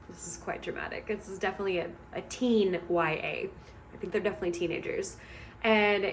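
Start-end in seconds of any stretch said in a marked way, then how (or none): 0.1-1.1 a hushed, amused whisper to the viewer; whispered aside. Real, not acted. Reviewer whispers a wry aside about the drama. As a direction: lean in and whisper it. Aside while summarizing the comic.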